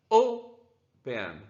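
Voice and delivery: "open" split, with a strong O and lighter "pen"; stress on first syllable. Shown as a demonstration. Teacher repeating for students which syllable is strong, first-syllable stress on "open". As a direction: lean hard on the O of "open", then drop off. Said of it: "Quando a sílaba forte".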